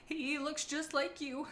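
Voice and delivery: hesitant, drawn-out nervous character voice; nervous reply. A narrator's reading. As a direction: read it as an uneasy, careful reply. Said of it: "she replied nervously".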